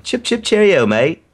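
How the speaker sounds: high, chirpy fake British accent; silly singsong British send-off; mock British. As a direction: do a hammed-up cartoon British accent. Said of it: "make the best impression of Badge".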